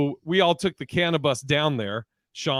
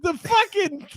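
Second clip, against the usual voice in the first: mock disbelief; 4 dB louder, slower than usual. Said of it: "You go".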